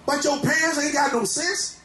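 full-force preaching, shouting over the organ. Real, not acted. Preacher exhorting congregation.

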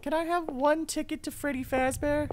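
high, playful customer voice; silly high voice. Pretend. Playful ask at cinema.

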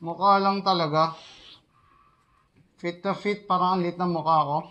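muffled behind mask; speaking inside full respirator; through a gas mask. Real, not acted. Seen 0: Speaker wears full-face gas mask on face throughout labelled words.

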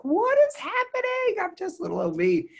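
put-on high squealing "what is happening", then still high for "little old me"; mock shocked squeal. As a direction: shriek it like you can't believe your luck. Retold shock at podcast ranking.

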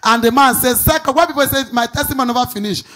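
loud, driving sermon delivery; preaching at full force. Acted. Pastor rousing the congregation with his prophecy, preaching loudly. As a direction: hammer each line home to the crowd.

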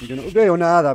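loud, high cry of alarm; real panicked outburst; panicked yell. Real, not acted. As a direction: burst out in panic at being blind in the dark. Panics, cannot see in dark.

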